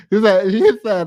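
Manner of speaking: ordinary talk, nothing marked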